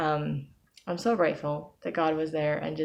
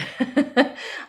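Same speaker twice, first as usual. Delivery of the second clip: laugh; 10 semitones higher, 3 dB louder than usual. Real, not acted. Laughs at own admission.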